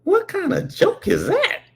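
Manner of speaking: put-on kid's puzzled squeak. Young narrator baffled by the joke, high squeaky kid voice. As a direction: ask it like a baffled small boy. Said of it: "I'm like".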